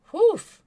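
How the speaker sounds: loud high whoof; exclaimed whoof. Real, not acted. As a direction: blow out a big whoof. Reacting to a burn.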